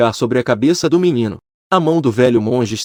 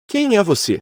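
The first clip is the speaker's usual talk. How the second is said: surprised; 6 semitones higher than usual. Acted. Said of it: "O velho monge ficou surpreso".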